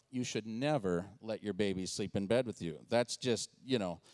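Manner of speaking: low, slightly softened know-it-all parent voice; mock advice in a low register; judgmental parents. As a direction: lecture like a disapproving parent. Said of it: "some of you parents in this room going".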